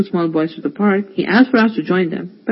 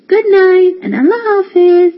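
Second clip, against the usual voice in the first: put-on high, louder children's voices; little boys in chorus; 12 semitones higher, 6 dB louder than usual. The two boys answering Irfan bhai together, childlike. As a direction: chirp it like small boys. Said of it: "said the boys together".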